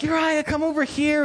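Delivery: high, eager call to come over. Retold or imitated. King summoning the soldier, high cheery beckon. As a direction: beckon him across with false cheer. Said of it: "he's like".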